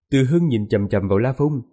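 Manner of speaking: ordinary delivery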